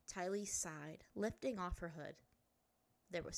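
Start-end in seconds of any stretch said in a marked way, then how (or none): none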